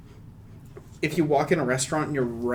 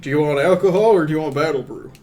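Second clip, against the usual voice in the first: bartender voice; 7 dB louder, faster than usual. Acted. Bartender asks, fast and loud.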